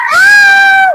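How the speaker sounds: sudden high, loud gasp; startled gasp. Shown as a demonstration. Teacher shows children a startled gasp at a firecracker. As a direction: jump at a firecracker bang. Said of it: "Gimana takut?".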